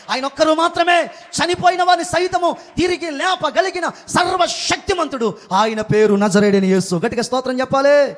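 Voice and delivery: loud, high revival shout with stretched words; full-force preaching. Acted. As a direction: proclaim it to the whole congregation at the top of your voice. (పూర్తి బలంతో గట్టిగా అరుస్తూ ప్రసంగం)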